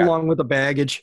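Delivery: says it through a chuckle; a wry laugh on the punchline; amused, laughing it off. Real, not acted. Wry joke about the merger.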